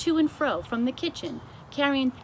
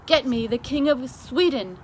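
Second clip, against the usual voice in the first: louder royal demand; curt order; 4 semitones higher, 8 dB louder than usual. Acted. The queen demands the King of Sweden, loud and curt. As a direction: bark it down the line.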